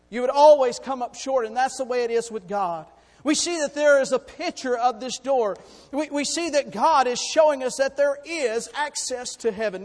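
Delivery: loud preaching build; preaching swell. Acted. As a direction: lift it to the congregation.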